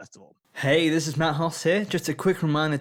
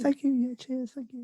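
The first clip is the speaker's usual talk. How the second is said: polite crowd; 9 semitones higher than usual. Retold or imitated. Joining the polite crowd impression.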